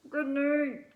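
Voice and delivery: a bolder creature calling goodnight; lightly voiced animal goodnight. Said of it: "all of the different animals saying good night".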